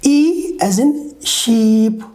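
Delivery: "E as in sheep" slow, ee held long. Shown as a demonstration. Teacher repeating the long vowel, slow held ee. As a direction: slowly hold the ee in "sheep". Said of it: "listen again".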